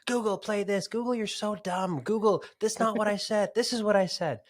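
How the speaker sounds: put-on high, loud, indignant kid voices; high-pitched, hammed-up kids berating a gadget; kids yelling at Google. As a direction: yell bossy orders like an exasperated child. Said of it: "they were just yelling at Google".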